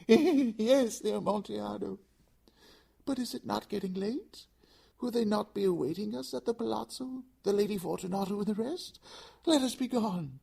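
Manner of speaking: high, loud laughing plea; frantic forced cheer. Acted. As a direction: keep up the joke, high and desperate. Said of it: "a sad voice".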